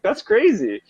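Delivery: high, lightly amazed remark; mock amazement. Watching thoughts like a film.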